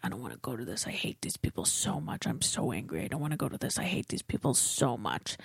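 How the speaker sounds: low, soft fuming under her breath; muttered fuming. Retold or imitated. Driving to his family's dinner, muttering angrily under her breath. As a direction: seethe quietly to yourself. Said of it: "the whole time I'm just fuming".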